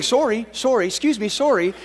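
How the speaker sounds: mock-polite stepping-over apology, with a laugh; polite Canadians. Pastor teasing Canadians who step over a trapped kid, mock-polite. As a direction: ham up a polite Canadian excusing himself. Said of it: "Canadians, hey?".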